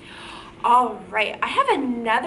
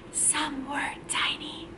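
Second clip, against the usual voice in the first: whisper; 10 dB quieter than usual. Shown as a demonstration. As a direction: whisper it as if tiny. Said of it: "We gotta whisper that part".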